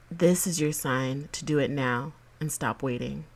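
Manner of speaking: soft, earnest pep talk reading; motivational affirmation. Acted. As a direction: read it like a gentle daily affirmation.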